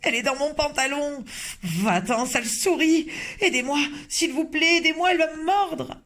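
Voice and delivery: stupid cook yelling about the mouse; panicked yelling. Acted. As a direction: beg for help in terror.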